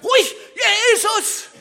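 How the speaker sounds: very high, very loud yell; shouting past the crowd to reach Jesus; full-throated scream. Retold or imitated. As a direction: scream over everyone trying to silence you. Blind man keeps shouting.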